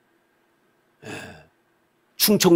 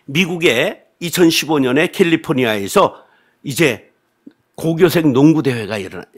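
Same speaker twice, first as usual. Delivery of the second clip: ordinary talk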